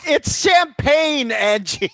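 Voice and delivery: loud, high, drawn-out cry; exclaiming. Real, not acted. Co-host exclaims to Angie over her champagne, loud exclaiming. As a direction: call it out high and stretched.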